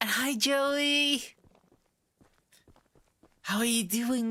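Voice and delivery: voice cracking. Real, not acted. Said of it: "I don't know why I have voice cracks".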